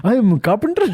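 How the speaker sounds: high, loud laughing uncle; hammed-up Punjabi uncle. Voicing uncle at border.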